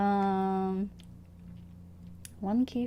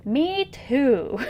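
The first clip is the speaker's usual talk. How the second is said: excited; 5 semitones higher, 7 dB louder than usual. Real, not acted. Excited agreement while streaming.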